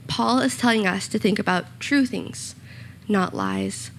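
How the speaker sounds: usual speaking voice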